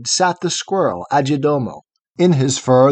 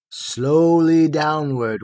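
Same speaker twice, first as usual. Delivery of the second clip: plain reading voice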